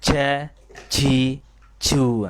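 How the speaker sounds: Ja, ji, ju dengan logat Jawa, ditekan dan dibanting. Shown as a demonstration. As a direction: contohkan jim ala logat Jawa. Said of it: "ini pada logat Jawa". (guru menirukan jim logat Jawa)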